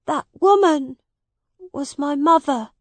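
high, pained admission; sadly. A narrator's reading. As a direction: say it sorrowfully, voice lifted. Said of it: "said Tom sadly".

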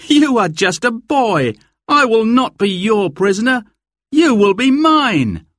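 high, forceful defiance, slowing at the end; defiant. A narrator's reading. Will refuses capture by an armed boy, high and defiant. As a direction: throw it back high and defiant.

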